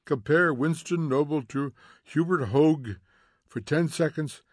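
ordinary delivery

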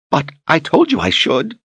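high, tearful whine; tearful pleading. Acted. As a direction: plead through tears. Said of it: "She wiped tears from her eyes".

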